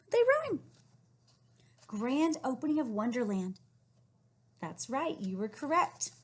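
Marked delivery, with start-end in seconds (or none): 0.1-0.6 high, loud cheer; excited praise. Real, not acted. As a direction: celebrate the rhyme. Praising rhyme discovery.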